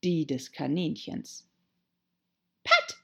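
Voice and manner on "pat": very high, loud angry call; furious Rabbit. A narrator's reading. Angry Rabbit hunting for Pat, high enraged shout. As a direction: yell for your servant in an irate high voice. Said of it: "kam eine erboste Stimme, die des Kaninchens".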